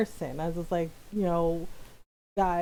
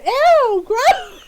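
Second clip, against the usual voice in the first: loud, much higher grossed-out yell; disgusted; 12 dB louder than usual. Retold or imitated. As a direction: recoil from something icky, loudly. Cousins recoiling in disgust.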